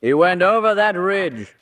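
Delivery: a gentleman shouting directions down to the police. Acted. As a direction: yell the directions so they carry.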